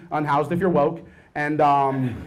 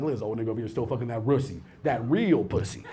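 low mocking youngster; 7 semitones lower, 8 dB quieter than usual.

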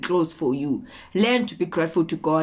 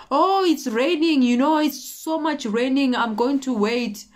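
mock excuse-making; 6 semitones higher than usual. Procrastinator making excuses about the rain, mock complaining. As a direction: put on a reluctant excuse-maker, pitched up.

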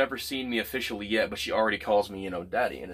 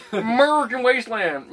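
high, loud mock announcement; patriotic yell; 13 semitones higher, 9 dB louder than usual. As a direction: proclaim it with hammed-up patriotic gusto. Keeps up the patriotic parody.

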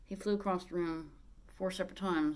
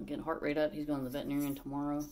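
ordinary talk

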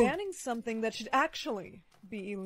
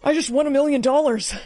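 louder, thrilled disbelief; excited shout; 4 semitones higher, 9 dB louder than usual. Acted. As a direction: yell like you just won big. Lottery win.

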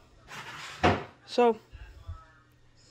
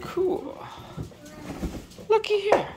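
high coaxing pet voice; slower than usual. Real, not acted. Owner calling a pet snake, high coaxing voice. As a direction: sing it up to catch the pet's eye.

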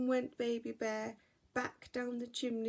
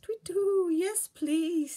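much higher, louder hooting owl; 9 semitones higher, 9 dB louder, slower than usual. Acted. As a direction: hoot it like a friendly owl. Owl accepts.

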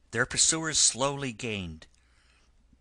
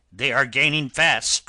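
louder, urgent cry of warning; raised voice, sounding the alarm; 3 semitones higher, 8 dB louder than usual. A narrator's reading. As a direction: cry out the alarm to those beside you. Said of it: "cried the engine driver".

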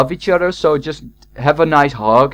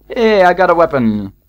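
usual speaking voice

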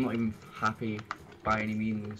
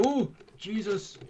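surprised; 9 semitones higher, slower than usual. Real, not acted. Gaming reaction.

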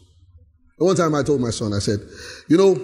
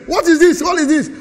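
louder, higher indignant scold; mock angry father; 10 semitones higher, 5 dB louder than usual. Father scolding his son over a haircut, mock indignant. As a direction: demand it like an outraged dad.

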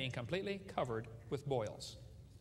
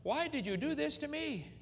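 pleading question; 10 semitones higher than usual. Acted. Voicing sufferer's question.

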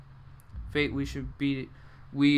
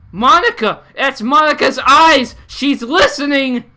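high, loud alarmed yelling, slowing down; panicked shouting; 10 semitones higher, 14 dB louder, slower than usual. Real, not acted. Player yells in panic on spotting Monica's eyes.

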